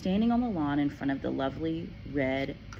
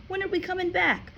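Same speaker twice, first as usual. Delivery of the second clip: put-on much higher girl's voice; anxious child pleading; worried girl. A narrator's reading. Matilda asks her father when they return. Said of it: "Matilda cried".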